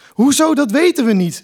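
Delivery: loud, high, disbelieving cry; a son yelling at his parents in disbelief; indignant shout. A narrator's reading. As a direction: shout it back in outrage. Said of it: "riep Kai na de zevende keer".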